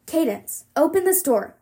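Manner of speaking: usual speaking voice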